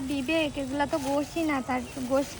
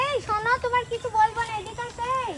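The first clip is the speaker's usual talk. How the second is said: high, loud call to come over; yelling across the room; 8 semitones higher, 6 dB louder than usual. Real, not acted. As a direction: call him over from a distance. Calling boy who walks away.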